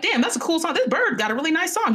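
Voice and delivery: high-pitched, excited admiration; impressed goldsmith. Retold or imitated. Goldsmith hearing the bird's song from inside, impressed. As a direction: imitate someone pleasantly wowed.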